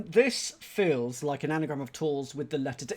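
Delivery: ordinary talk, nothing marked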